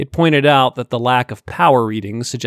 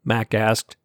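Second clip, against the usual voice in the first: ordinary delivery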